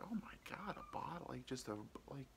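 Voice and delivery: mock gasped near-whisper of disbelief; hushed shock. Child hears dad was hit by a bottle, hushed retold shock. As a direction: drop to a very soft, breath-only reaction.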